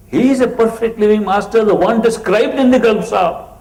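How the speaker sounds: newly initiated father telling his son about the guru, ecstatic; ecstatic praise. Retold or imitated. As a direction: gush about the living guru.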